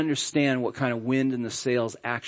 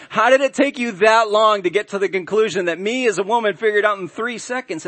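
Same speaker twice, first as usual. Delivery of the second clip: smug wife; 7 semitones higher, 5 dB louder than usual. Retold or imitated. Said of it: "You know, like".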